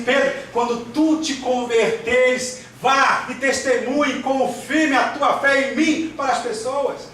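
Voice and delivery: high, slow, commanding charge; Jesus. Acted. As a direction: stretch out the command with preaching fervour. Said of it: "Ele diz assim". (ordem de Jesus, lenta e em tom alto)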